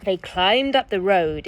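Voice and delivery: ordinary delivery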